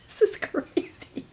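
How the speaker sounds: breathless laughing whisper. Real, not acted. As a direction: laugh it out under your breath. Laughing disbelief at own story.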